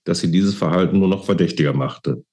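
ordinary delivery

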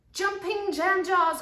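high, loud, slow outburst of alarm; loud cry. Acted. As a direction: cry it out, stretching each word. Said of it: "cried Munch out loud".

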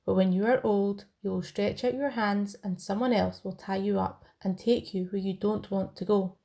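normal talking voice

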